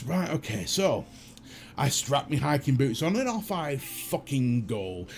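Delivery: ordinary delivery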